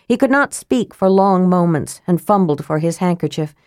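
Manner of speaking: ordinary delivery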